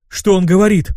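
a hint of a baffled crowd; puzzled trolls asking. A narrator's reading. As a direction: ask it as a lightly voiced confused troll. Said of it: "начали вопрошать тролли".

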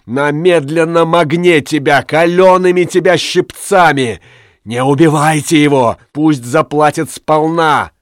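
high, impassioned vengeful tirade; woman shouting for full payback; vengeful crying out. A narrator's reading. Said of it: "кричала Тория".

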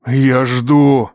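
loud, commanding ultimatum; threatening boom. A narrator's reading. Father with pistol awaits an answer, thunderous threat. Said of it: "угрожающе прогремел отец".

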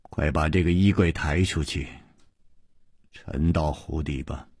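low, soft, solemn command; deep, grave voice. A narrator's reading. Master ordering disciples to sink the wardrobe, deep grave voice. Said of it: "接着就听他沉声说".

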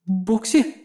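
soft uncertain question; muttered. Acted. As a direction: murmur the name in disbelief. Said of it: "пробормотала Физзи".

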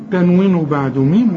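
ordinary talk, nothing marked

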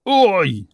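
high-pitched "ow" cry; high cry of pain; boy's yelp. A narrator's reading. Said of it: "скрикував Іван".

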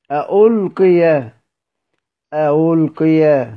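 أألقي slowly, second hamza between hamza and waw; eased hamza. Shown as a demonstration. Teacher models a damma example, eased hamza in أألقي. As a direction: let the second hamza of أألقي glide toward a w. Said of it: "وتجعل المضمومة بين الهمزة والواو".

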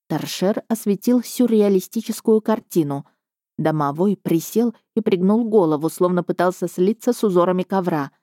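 plain reading voice

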